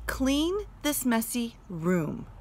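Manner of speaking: slow, patient explaining; mother explaining slowly; explained. Shown as a demonstration. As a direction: spell it out slowly. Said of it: "explained Mother".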